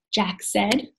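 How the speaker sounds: usual speaking voice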